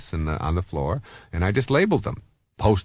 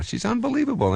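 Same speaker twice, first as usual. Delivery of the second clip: much higher, marvelling; husband bragging about his wife's bowling, amazed; proud amazement. Real, not acted. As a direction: gush about her with pride. Praising wife's bowling progress.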